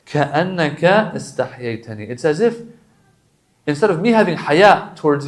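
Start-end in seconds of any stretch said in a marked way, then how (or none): 0.1-2.1 quick recitation. Acted. Reciting Arabic du'a.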